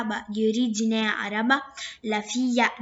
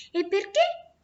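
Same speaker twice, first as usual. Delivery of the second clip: curious question; 8 semitones higher than usual. Acted. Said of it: "quello incuriosito".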